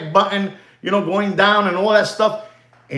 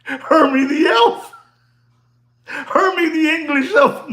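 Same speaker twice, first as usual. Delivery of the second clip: high, laughing exclamation; cracking up while calling out the name; amused; 7 semitones higher than usual. Real, not acted. Recognising who he sounds like, laughing high.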